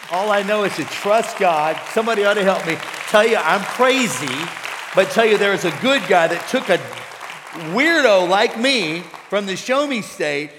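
loud, impassioned preacher building to a peak; full-force preaching. Real, not acted. Preacher testifies he's called to reach losers, impassioned full force. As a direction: belt it out to rouse the congregation.

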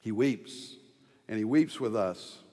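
soft, low and tender. Real, not acted. Pastor tells mourners Jesus weeps, soft and tender. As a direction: let it sink in gently. Preacher softens on Jesus weeping.

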